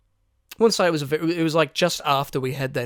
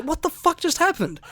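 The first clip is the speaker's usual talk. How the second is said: high-pitched panicked disbelief, re-enacted; retold panic; 13 semitones higher than usual. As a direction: replay a panicked reaction, pitched way up. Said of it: "I was panicking".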